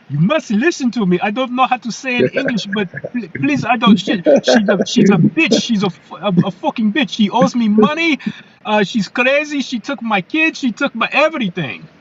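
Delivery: put-on high-pitched, broken English with a European accent; a father-in-law in thick European accent, urgent and pleading; imitating thick European accent. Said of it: "in his thick European accent".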